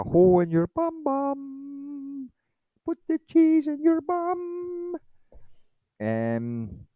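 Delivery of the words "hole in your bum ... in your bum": very high, slow, squeaky silly voice; cult movie quote voice. Retold or imitated. Mocking a UFO insider with a silly high movie-quote voice. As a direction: stretch it out in a goofy high squeal. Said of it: "that's from a f-, a, a famous cult movie".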